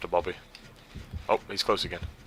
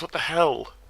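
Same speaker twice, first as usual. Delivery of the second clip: ordinary talk, nothing marked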